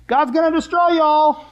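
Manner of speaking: high, loud, drawn-out street-preacher holler; mock screaming. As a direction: holler a doom warning slowly across town. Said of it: "just screaming that out".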